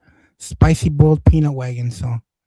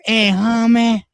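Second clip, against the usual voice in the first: lightly voiced, higher-pitched street hustler; retold panhandler; 7 semitones higher, much slower than usual. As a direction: imitate a pushy beggar calling out. Voices the man begging.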